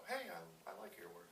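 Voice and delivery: small, high, soft voice; mock hesitant admirer; imitated shy fan.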